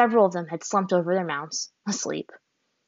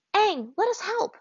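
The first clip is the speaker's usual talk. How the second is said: a lightly voiced commanding girl, much higher; ordered; 13 semitones higher, slower than usual. A narrator's reading. Azula to Aang, a high, slow order. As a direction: insist on joining the fight. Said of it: "Azula ordered".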